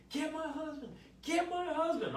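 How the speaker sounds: high-pitched, insistent patient outcry; demanding patient. Retold or imitated. Patient in recovery calls for her husband, high mimicked demand.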